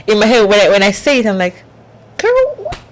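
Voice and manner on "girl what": very high, mock-baffled girl what; incredulous squeak. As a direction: squeak it in disbelief at yourself. Mocking her own words.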